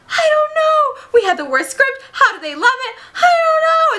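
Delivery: very high squealing wail; hammed-up hysterical whine; mock panic. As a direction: squeal it as if falling apart. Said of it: "starts having a panic attack".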